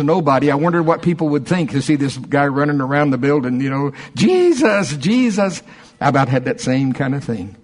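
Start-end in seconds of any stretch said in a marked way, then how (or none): 4.1-5.6 excited calling out. Retold or imitated. Imagined running around the building.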